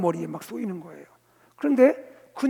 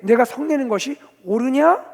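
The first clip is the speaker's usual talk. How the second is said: loud, raised question, rising at 옳으냐; challenging question; 5 semitones higher, 8 dB louder than usual. Acted. Preacher as God questioning the prophet, challengingly. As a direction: question firmly, pitch lifted at the end. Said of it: "하나님은 요나에게".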